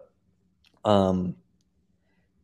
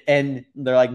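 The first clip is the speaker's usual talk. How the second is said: usual speaking voice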